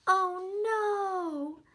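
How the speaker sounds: loud, high dismayed cry; alarmed. Acted. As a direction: gasp it out like bad news. Story alarm, reading to kids.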